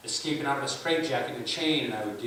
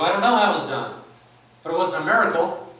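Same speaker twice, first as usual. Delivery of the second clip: insistent skeptic; 5 semitones higher, 9 dB louder than usual. Retold or imitated.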